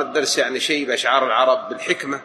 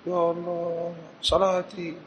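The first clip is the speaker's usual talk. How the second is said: soft, high, drawn-out mock wail; imitated crying; 5 semitones higher, 7 dB quieter, slower than usual. Teacher acting the shocked old woman, imitated weeping. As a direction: mildly act out a tearful lament. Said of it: "بدأت تبكي".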